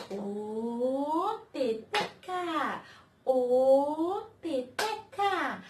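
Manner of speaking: long, drawn-out loud call. Acted. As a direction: call her from far away. Calling the puppet.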